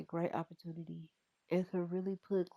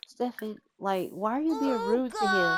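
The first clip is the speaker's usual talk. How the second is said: scolding big sister; 5 semitones higher, 7 dB louder than usual. Pretend. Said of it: "She's like".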